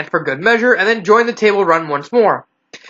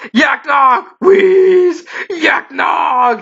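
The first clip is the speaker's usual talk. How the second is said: panicked shout; 7 semitones higher, much slower than usual. A narrator's reading. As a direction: scream the warning in terror. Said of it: "in more panic".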